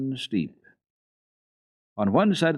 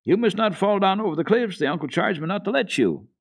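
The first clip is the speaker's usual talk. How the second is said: calling warning; 11 semitones higher, 5 dB louder than usual. A narrator's reading. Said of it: "called Peter again".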